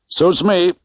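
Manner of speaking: a hint of a breezy Vegas cabbie; driver Correa. A narrator's reading. Driver Correa voiced by narrator.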